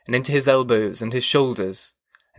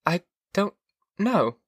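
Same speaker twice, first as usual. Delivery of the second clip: higher, halting wife's voice; wife searching and failing, pitched up; wife at a loss; 8 semitones higher than usual. A narrator's reading. Wife cannot remember meeting her husband, halting high voice. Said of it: "she said".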